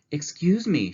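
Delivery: Mrs. Prim's voice. Acted. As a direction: politely stop a stranger. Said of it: "she said to the lady".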